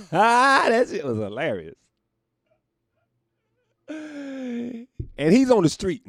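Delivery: high, loud through laughter; laughing. Real, not acted. Cracking up about the heckler, loud laughing. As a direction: crack up while you say it.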